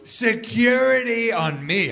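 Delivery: high drawn-out mock yell for security; loud yell. Woman at airport calling security, a high slow yell. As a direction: call out for security, slow and shrill-free. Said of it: "she loudly yells".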